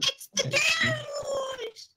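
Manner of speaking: high, long drawn-out mocking cry; mock alt-right debater. Woman mimicking alt-right debaters who change the subject, mock cry. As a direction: stretch it out high, parodying a debater's stock answer. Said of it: "the alt-right debate, they".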